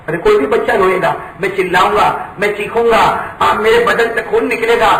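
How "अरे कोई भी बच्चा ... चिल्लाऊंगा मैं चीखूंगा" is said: ordinary talk, nothing marked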